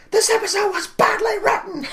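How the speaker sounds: very high, loud shrill movie quote; Sigourney Weaver impression. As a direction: shriek it like Gwen in Galaxy Quest. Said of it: "Galaxy Quest".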